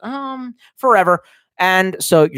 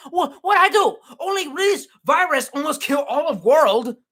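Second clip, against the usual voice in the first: put-on high-pitched caricatured Chinese accent; mock Chinese accent; 11 semitones higher than usual. Streamer likening a viewer to China's excuses, mock Chinese accent. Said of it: "You're the Chinese going".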